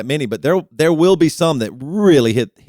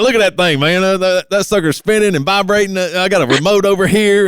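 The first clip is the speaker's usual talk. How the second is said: put-on higher, louder Southern good ol' boy voice; Southern accent; 7 semitones higher, 4 dB louder than usual. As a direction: brag like a Southern tech guy showing off his toy. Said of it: "I don't know why I went Southern".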